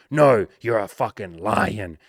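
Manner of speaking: loud, slow bellowed encouragement; roaring hype. Retold or imitated. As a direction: roar it so he believes it. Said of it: "We're like".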